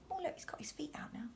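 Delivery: fast hushed whisper; excited whispered aside. Real, not acted. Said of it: "aside to listening child".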